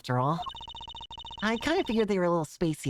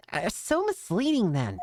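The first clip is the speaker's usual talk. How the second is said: usual speaking voice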